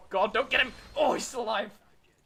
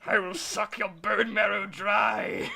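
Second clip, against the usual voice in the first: slow, loud monster growl; dragon voice; 6 dB louder, slower than usual. Acted. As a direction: threaten like a hungry beast. Gloating villain voice continues.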